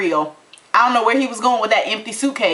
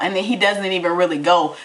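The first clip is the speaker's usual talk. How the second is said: usual speaking voice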